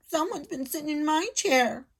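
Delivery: put-on high squeaking baby bear; squeaky Baby Bear. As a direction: squeak like a tiny cub. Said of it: "squeaked Baby Bear".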